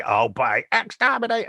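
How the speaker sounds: a hint of a metallic Dalek; quick mock Dalek exterminate; sketched Dalek cry; lightly done Dalek voice. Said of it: "your bog standard way".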